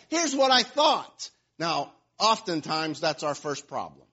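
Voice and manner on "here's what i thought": higher, louder outburst; angry shout. Retold or imitated. Preacher acting Naaman's rant, shouting. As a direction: bark out his complaint. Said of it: "And he says".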